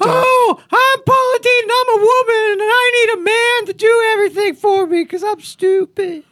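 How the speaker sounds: hammed-up southern lady, near tears; Paula Dean impression. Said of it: "I'll do Paula Dean".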